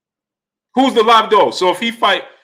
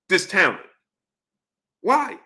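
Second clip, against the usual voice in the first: ordinary delivery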